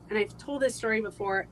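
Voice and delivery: usual speaking voice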